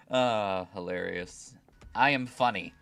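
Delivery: ordinary delivery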